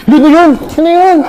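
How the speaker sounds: loud, high demanding yell; high shout. Acted. Child yelling at her sibling during a fight, high and loud. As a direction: shout it up the stairs.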